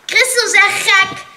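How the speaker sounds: high, loud jeer; called out. A narrator's reading. Patrick calling out that Christel is crazy, loud. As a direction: yell it across the circle. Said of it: "riep Patrick".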